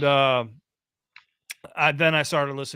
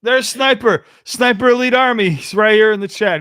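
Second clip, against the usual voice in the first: loud, high, thrilled callout to the chat; pointing out a friend in chat, loud and delighted; excited shout-out; 9 semitones higher, 10 dB louder than usual. Real, not acted. Streamer excitedly calls out a viewer spotted in chat, loud and high.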